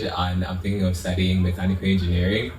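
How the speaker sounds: normal talking voice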